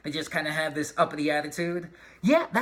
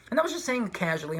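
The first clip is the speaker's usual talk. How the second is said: usual speaking voice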